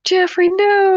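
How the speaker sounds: high-pitched cry of protest; high, playful wail of dismay. Acted. Player cries out to Jeffrey.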